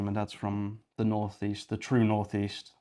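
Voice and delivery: ordinary delivery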